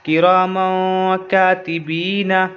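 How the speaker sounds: "किरामों कातिबीना" slowly, "मों" held in the nose; ikhfa nasal hold. Shown as a demonstration. Teacher completes the word, ikhfa nasal hold. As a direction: linger nasally on "मों", long "बी". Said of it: "नाक में आवाज़ को रोकेंगे".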